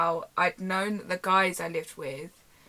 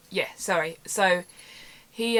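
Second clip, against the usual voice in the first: ordinary talk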